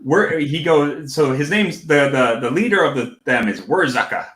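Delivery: usual speaking voice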